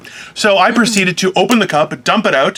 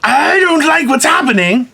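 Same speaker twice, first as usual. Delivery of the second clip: exasperated outburst; 10 semitones higher than usual. Retold or imitated. Bad day at the store, mock exasperated outburst. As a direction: blurt out that you hate this. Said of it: "where I'm like".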